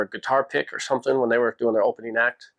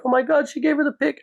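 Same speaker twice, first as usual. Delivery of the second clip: much higher, excited squeal of fans; 14 semitones higher than usual. Retold or imitated. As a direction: mimic thrilled teenage fans. Fans behind react excitedly.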